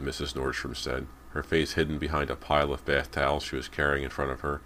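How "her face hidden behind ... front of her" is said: usual speaking voice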